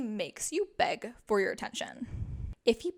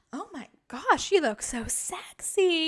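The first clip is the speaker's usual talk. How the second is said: higher, louder, slow and gushing; excited compliment; 6 semitones higher, 7 dB louder, slower than usual. Retold or imitated. Boyfriend Zach gushing over her new haircut, excited. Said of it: "he told me".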